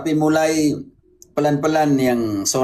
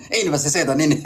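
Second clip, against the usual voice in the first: laughing mock denial; faster than usual. Playfully mimics her denial, laughing.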